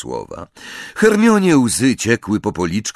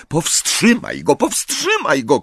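shout; 7 semitones higher than usual. A narrator's reading. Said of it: "krzyknęła Hermiona".

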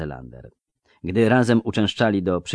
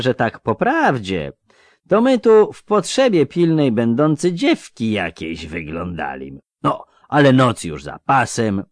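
higher, louder folksy bandit voice; lisping thug; 4 semitones higher, 5 dB louder than usual. Acted. Robber explaining to the poor traveller, lisping and threatening. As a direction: jeer at the victim, then rally the gang.

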